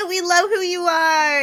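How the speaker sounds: high, loud, crying with happy excitement; tearful happy cheer; tearful joy. Real, not acted. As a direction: squeal it through happy tears. Song moves her to tears.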